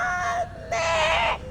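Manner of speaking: very high, thin bird squeal; bird-like whistle. Shown as a demonstration. Teacher has pupils copy a bird, whistled squeal. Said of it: "whistle it like a bird".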